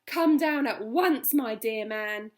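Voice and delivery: loud, slow, urgent call; loud and eager. Acted. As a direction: summon him down at once. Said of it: "Missus Silver answered".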